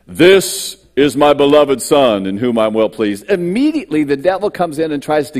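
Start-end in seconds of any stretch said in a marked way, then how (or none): 0.1-3.3 loud, slow divine proclamation; voice of God. Acted. Preacher recalling the voice from heaven, loud slow proclamation. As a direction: declare it grandly as God from heaven. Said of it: "I'll give you the God version".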